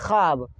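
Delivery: ख्वाब said as khaab; no w in ख्वाब, just long aa; silent waw. Shown as a demonstration. Teacher answering own question to learners, waw left silent. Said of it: "तो क्या कहते हैं".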